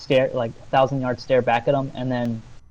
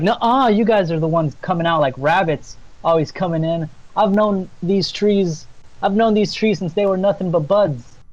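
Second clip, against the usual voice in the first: higher-pitched, indignant nymph voice; 5 semitones higher than usual. Acted. Nymph blames lumberjacks for felled trees, indignant. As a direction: argue back as an aggrieved nymph. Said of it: "the nymph's like".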